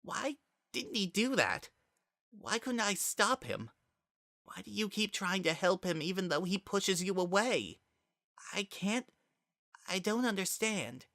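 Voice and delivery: high, soft, hurting questions; quiet and lost, almost pleading; a broken boy asking why, very soft. Acted. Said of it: "He grew quieter still".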